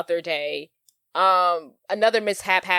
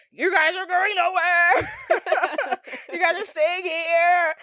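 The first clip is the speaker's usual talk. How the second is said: slow, higher, creepy sing-song threat; creepy menace; 10 semitones higher, slower than usual. Retold or imitated. Limo guy turning on the trapped sisters, slow creepy menace. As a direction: drag it out like a creep toying with his prey. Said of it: "he just turns straight creepy".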